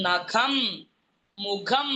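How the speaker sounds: നഖം and മുഖം with a strong breathy kh; heavy kh. Shown as a demonstration. Teacher showing pupils how to weight the kh. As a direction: stress the aspirated kh in നഖം, മുഖം. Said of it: "ഇതൊക്കെ കനം വരുത്തി പറയേണ്ടതാ".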